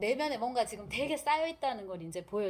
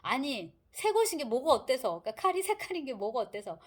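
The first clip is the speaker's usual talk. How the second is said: acted outburst of temper, louder and higher; flaring up; 4 semitones higher, 6 dB louder than usual. Rogozhin flaring up when questioned about his new knife. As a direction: snap back indignantly, voice jumping up. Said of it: "로고진이 버럭합니다".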